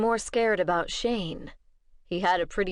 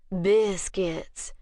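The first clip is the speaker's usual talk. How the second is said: slow drawl. Acted. Vampire woman asks Bishop for biscuits, slow drawl. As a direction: stretch the word out, lazy and slow. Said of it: "drawled the woman".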